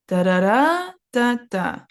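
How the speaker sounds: "Da, da, da" rising, then "da, da" falling; nonsense-syllable melody, "Da, da, da" climbing, "da, da" dropping; intonation pattern demo. Shown as a demonstration. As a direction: hum out the tune in syllables, "Da, da, da" up, "da, da" down. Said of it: "Up, down, down".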